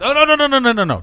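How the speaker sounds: reassuring doctor. Acted. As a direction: wave off the panic, overly chipper. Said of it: "He goes".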